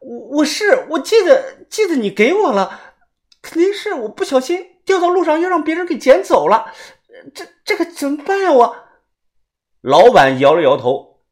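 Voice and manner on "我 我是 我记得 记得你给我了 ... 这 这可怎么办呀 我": very high, stammering distressed woman; close to tears. A narrator's reading. Woman panics to shop owner over lost jade, near-tearful high voice. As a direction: falter and wail, on the verge of crying. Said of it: "白霜的表情都快哭了".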